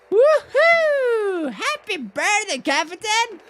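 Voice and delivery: high whooping cheer; hammed-up birthday whoop; party cheer. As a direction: cheer the captain like a party guest.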